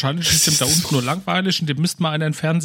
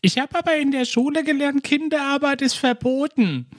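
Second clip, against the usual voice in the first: much higher voice imitating a smart-aleck kid; cheeky son; 11 semitones higher than usual. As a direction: mimic the son's cheeky protest. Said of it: "so ein Spruch von wegen". (den frechen Sohn höher nachgeahmt)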